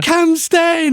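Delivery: high-pitched imitated child's cheer; little boy yelling from the stands; excited kid cheering. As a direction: call out to pump up the player. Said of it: "trying to pump Dale Steyn up".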